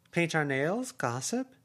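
voiced aunt, high and urging; effusive aunt. Aunt proposes fun night.

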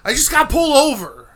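high, loud exasperated confession; upset outburst. Retold or imitated. Imitating his friend explaining the traffic stop, upset.

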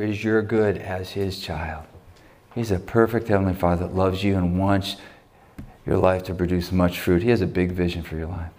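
leading prayer. Acted. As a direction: guide the room into prayer, gently. Leads the closing prayer.

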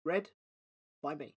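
plain reading voice

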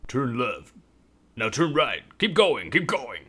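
lightly voiced navigator, pressing; urgent directions. Masked navigator directing the driver, lightly urgent. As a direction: call out the turns urgently.

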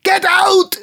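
shrill high scream, get out; furious scream. Acted. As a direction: scream him out of the shop. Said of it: "screamed Micah".

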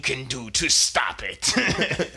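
high, loud, slow hammed-up gloat; gloating villain. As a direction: ham up a smug cartoon villain. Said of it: "gloating villain monologue".

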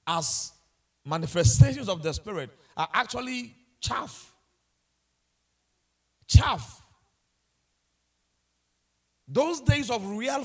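full-force sermon delivery; fiery preaching. Acted. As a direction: drive the point home from the pulpit.

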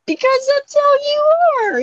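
high, slow mock accusation; high accusing voice. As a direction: squeak it out accusingly. Accusing friend playfully.

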